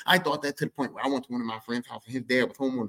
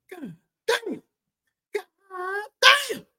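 very high awed exclamation; 4 dB louder, much slower than usual. Real, not acted. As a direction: marvel out loud. Awed reaction.

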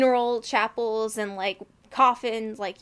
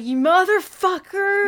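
loud, high, drawn-out mock outrage; outraged reaction; 8 semitones higher, 7 dB louder, slower than usual. Viewer reacting to the reveal in Widows, outraged. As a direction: curse the screen in hammed-up outrage.

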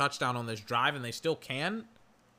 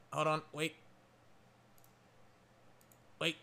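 ordinary talk

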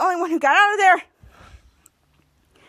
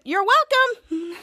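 higher, slowly stretched words; pointed reply; 4 semitones higher, much slower than usual. Retold or imitated. Quinn answers Captain Man's brush-off, slow pointed reply. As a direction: draw it out, pitched up.

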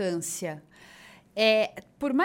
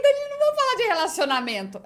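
mock protest; 10 semitones higher, 5 dB louder, faster than usual. Refusing the host's request.

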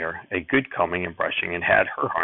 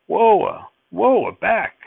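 much higher, louder shout; shouting at horses; 12 semitones higher, 7 dB louder, much slower than usual. Acted. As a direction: yell to halt the horses. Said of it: "he was shouting".

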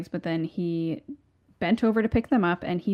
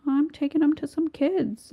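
higher, small innocent voice; timid reply; 9 semitones higher than usual. Retold or imitated. Paul answering the bullies, higher meek voice. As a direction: answer meekly and sweetly. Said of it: "he's like".

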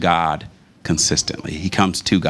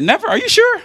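mom's shocked question, far higher and a bit louder; imitated disbelief; 4 dB louder than usual. Mother questioning her son's memory, high mock disbelief. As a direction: squeak out the doubt like mom did. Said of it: "she said".

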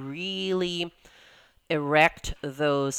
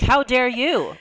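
high, loud mock outrage; mock indignant; 8 semitones higher, 6 dB louder than usual. Mocking clients who want free advice, in loud mock outrage. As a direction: throw up the hands in fake offence. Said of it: "I'm like".

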